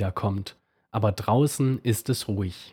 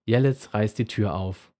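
ordinary delivery, nothing marked

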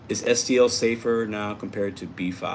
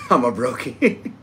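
slow, laughing through the words; laughing at being broke; 4 semitones higher, slower than usual. Real, not acted. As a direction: chuckle it out at your own joke.

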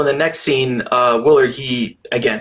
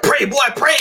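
commanding father; 12 semitones higher than usual. Retold or imitated. Host retelling a father forcing his son to pray, mock commanding. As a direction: imitate a father demanding prayer, pitched way up.

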